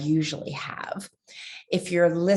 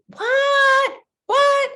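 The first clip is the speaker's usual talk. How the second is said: high shocked yelp, much louder; shocked shout; 6 dB louder than usual. Acted. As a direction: cry out in shocked disbelief. Shocked reply to puppet.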